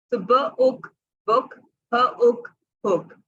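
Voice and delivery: ब उक बुक, sounds drawn out; slow blending. Shown as a demonstration. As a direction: say उक short, then join बुक. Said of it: "टू sounds देता है". (धीरे से जोड़ना)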